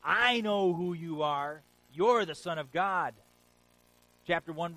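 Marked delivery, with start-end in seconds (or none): 0.0-3.2 louder and slow, a proclaimed cry; a preacher's mock demon shout. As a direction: bellow it slowly like a possessed man. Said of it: "the demon shouts out".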